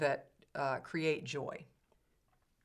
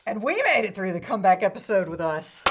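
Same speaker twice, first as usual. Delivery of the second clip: a big, high, booming repeat of the line; loud echo; 4 semitones higher, 11 dB louder than usual. Acted. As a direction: shout it back like a cheerleader. Echoes the line, louder and higher.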